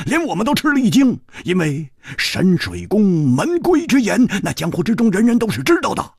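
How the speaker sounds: Hu Tiehua's voice. Acted. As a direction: retell the ordeal in a hearty, aggrieved rush.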